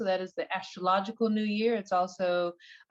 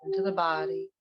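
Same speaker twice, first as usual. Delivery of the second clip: guided meditation. Acted. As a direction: ease them gently into relaxation, one unhurried phrase at a time.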